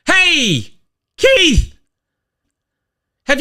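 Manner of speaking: thrilled to see a new viewer; excited greeting. Real, not acted. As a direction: call out to welcome Keith. Greeting newcomer in chat.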